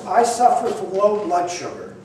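normal talking voice

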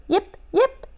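high, lightly voiced puppy whimper; a small dog whimpering for help. A narrator's reading. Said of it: "whimpered Zip".

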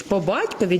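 usual speaking voice

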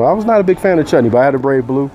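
usual speaking voice

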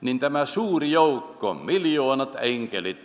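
ordinary talk

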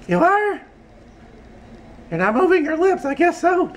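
very high puppet voice; squeaky, chirpy falsetto character; Audrey. Acted. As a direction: pipe up high as a little puppet. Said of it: "Here's my Audrey".

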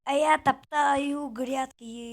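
slow, stretched-out taunt; teasing drawl. Real, not acted. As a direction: drag the words out to needle a playmate. Teasing chant while trampling garden beds. (дражливо, розтягуючи слова)

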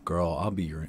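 ordinary talk, nothing marked

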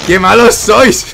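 loud, slow furious shout; raging gamer. Real, not acted. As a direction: rage at the enemy crew. Raging at enemy ship.

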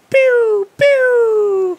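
high, loud laser gun pews; laser pew sound. Retold or imitated. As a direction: fire off cartoon laser pews. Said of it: "sound effects where it goes".

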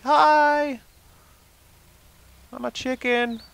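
very high, cute animal voice; chicken voice. Pretend. Chicken greeting the park ranger, very high and cute.

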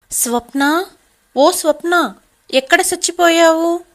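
loud, high angry summons across the house; angry calling. A narrator's reading. Kavitha angrily calls her sister-in-law Swapna, loud and high. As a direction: yell for her like she's fed up. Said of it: "కోపంగా కవిత తన ఆడపడుచుని పిలుస్తుంది".

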